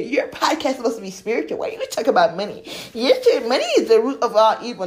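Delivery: exaggerated critic's complaint; mocking accusation. Mimics critics of her podcast.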